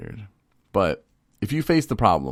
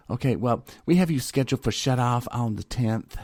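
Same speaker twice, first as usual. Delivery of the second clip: imitating phone agent; 6 semitones higher than usual.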